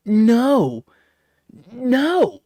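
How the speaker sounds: loud, high, drawn-out no; emphatic refusal. Real, not acted. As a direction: exclaim it slowly in exasperation.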